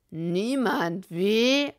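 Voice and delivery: loud grunt, climbing up; grunting Bill. A narrator's reading. Bill challenging the silent room, loud grunting. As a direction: grunt it out like a bully. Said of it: "grunzte Bill".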